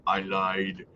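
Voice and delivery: low, soft Schwarzenegger voice; Arnold impression. Answering a viewer's chat jokingly, low soft Arnold voice. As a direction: drop way down and rumble it like the Terminator. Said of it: "in my best Arnold voice".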